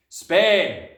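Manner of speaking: very loud, blunt announcement; curt father. A narrator's reading. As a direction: bellow the one word like an impatient dad. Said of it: "the father said".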